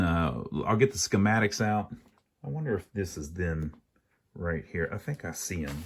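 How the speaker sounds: usual speaking voice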